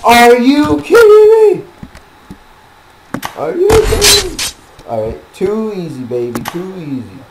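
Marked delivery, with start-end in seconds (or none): 0.0-4.4 high, loud disbelieving yell; frustrated shout. Real, not acted. Dying again in game.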